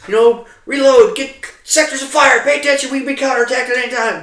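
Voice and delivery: high, loud commanding call; rally the troops sharply; yelled orders. Retold or imitated. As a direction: sergeant shouting at his squad to reload. Sergeant yelling orders to squad.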